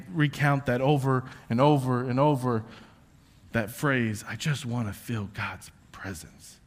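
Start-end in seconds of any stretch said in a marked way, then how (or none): 4.3-6.6 soft longing. Retold or imitated. Quoted longing phrase.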